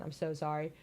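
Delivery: usual speaking voice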